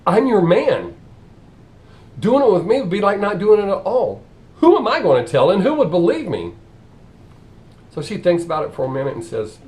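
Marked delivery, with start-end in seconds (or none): 0.1-6.5 higher, louder keen sales pitch; eager pitch. Acted. Bus driver eagerly offering himself to the nun. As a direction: sell yourself with eager confidence.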